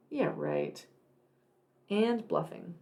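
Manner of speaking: usual speaking voice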